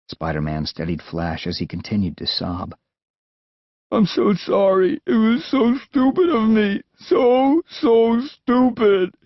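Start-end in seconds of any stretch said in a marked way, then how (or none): 3.9-9.1 high, tearful apology, drawn out on so stupid; crying. Acted. Scared boy crying to Spider-Man, tearful and high. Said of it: "Flash cried".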